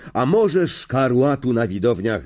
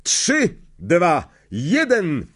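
higher referee countdown; 6 semitones higher, much slower than usual. Acted. Madam Hooch to the players, countdown. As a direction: count them off to the start.

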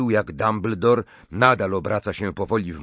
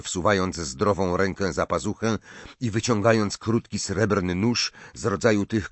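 ordinary delivery, nothing marked

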